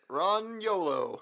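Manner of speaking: mock naming. Mock movie naming, drawn out.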